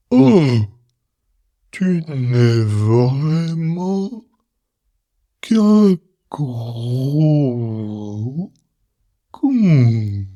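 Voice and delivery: very slow, stretched-out words like a film at quarter speed; slow motion insult. Pretend. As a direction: drag every syllable as if the film is slowed right down. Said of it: "elle parle comme ça, tout doucement".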